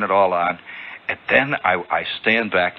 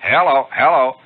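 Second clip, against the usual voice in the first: louder, higher test calls; loud mike test; 5 semitones higher, 7 dB louder than usual. Acted. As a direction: call firmly into a radio mike. Acts out testing the mike.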